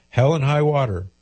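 normal talking voice

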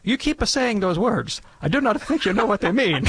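high-pitched, put-on comic foreign accent; Father Guido accent. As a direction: do a jokey, hammed-up accent, pitched well up. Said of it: "Thank you, Father Guido".

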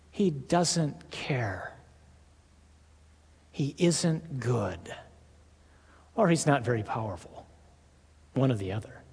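low, slow, hushed whisper from the devil; sinister low whisper, voice of the devil; whispered devil's lie. Acted. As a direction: breathe the lie in his ear like the tempter. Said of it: "That's his lie".

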